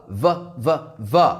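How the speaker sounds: "v" repeated as a buzzing v consonant with no vowel after; teacher models the final "v" of "have"; v sound demo. Shown as a demonstration. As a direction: hold the "v" as a voiced buzz. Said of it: "This is the sound".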